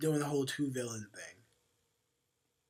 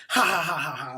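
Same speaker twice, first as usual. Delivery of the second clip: hammed-up 1930s villain laugh; 6 dB louder than usual. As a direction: cackle like an old movie villain. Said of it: "that type laugh".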